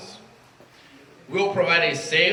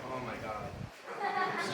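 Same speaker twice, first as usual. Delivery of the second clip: soft, choked-up oh my God; barely holding it together, much softer; holding back tears; 3 semitones lower, 12 dB quieter than usual. Real, not acted. New club president overwhelmed at the podium, soft and near tears. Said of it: "I'll try not to cry".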